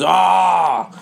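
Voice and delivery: put-on loud high drunken yell; yelling after him as I run; shout. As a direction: bellow like a drunk KGB man. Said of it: "he goes".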